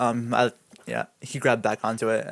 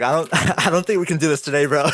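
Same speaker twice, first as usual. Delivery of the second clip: quick, higher reply broken by laughter; laughing; 4 semitones higher, 3 dB louder, faster than usual. Retold or imitated. Telling a friend they can't record today, laughing. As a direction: laugh through the excuse.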